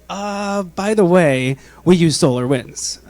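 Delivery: a lightly voiced nervous IT guy; mock confession. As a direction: play the IT guy dropping bad news.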